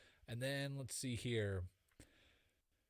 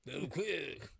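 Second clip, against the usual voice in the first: French accent; 3 semitones higher than usual. Shown as a demonstration. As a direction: put on a French accent for Leclerc. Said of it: "That's my best French accent I got".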